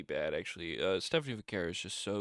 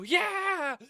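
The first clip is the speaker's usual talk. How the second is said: high, loud mock cheer; playful high crowd yeah; 7 dB louder than usual. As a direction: cheer like the fans.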